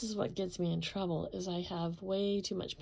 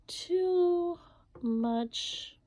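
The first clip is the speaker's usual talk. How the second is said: too much, too much higher and louder; emphatic too; 11 semitones higher, 5 dB louder than usual. Real, not acted. Painter stressing she uses too much paint, emphatic. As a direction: hit too much high and loud.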